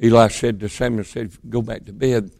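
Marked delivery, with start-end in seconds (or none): 1.5-2.3 soft, low, gentle send-off; not so harsh. Acted. Eli sends Samuel back to bed, less harshly. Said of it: "wasn't as harsh with him this time".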